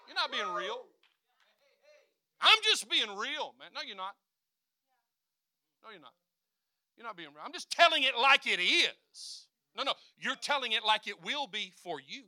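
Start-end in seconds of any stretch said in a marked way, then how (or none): 2.4-3.7 high, loud, slow whiny complainer; mock the complainer's excuse. Mocks a complaining believer. 7.4-9.5 high, loud, slow whiny complainer; mock the complainer's excuse. Mocks a complaining believer.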